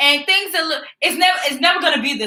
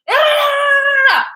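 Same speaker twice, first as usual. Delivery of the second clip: mock shout; 12 semitones higher, 5 dB louder than usual. Retelling a sudden clash.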